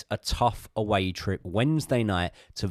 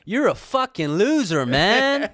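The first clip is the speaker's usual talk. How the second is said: hammed-up American, higher-pitched; American accent; 5 semitones higher, 3 dB louder than usual. Playing Todd Boehly insulting himself in the mirror, hammed-up American. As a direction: lay on a mock American accent. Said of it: "Can you do it in the accent, please?".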